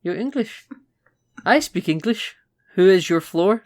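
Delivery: a lightly put-on Dutch accent, fumbling the English; bumbling Dutchman. Bond playing a fumbling Dutchman at the lift, in a mock Dutch accent. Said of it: "he pretends to be a bumbling Dutchman".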